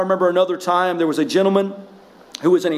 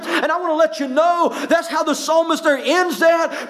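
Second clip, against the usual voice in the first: raised, fervent pulpit voice, pitched up high; full-force preaching; 9 semitones higher than usual. Acted. Pastor to his congregation, fervent full-force preaching. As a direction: preach it to the back pews.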